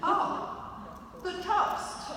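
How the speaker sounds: high startled oh the toast; he just remembered the toast; mock husband's surprise; sudden realization. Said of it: "And he went".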